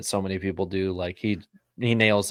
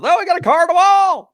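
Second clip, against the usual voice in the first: imitated excited commentator shout; Dale Junior; 13 dB louder than usual. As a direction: yell the crash call very high and loud. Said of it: "Just like Dale Junior is".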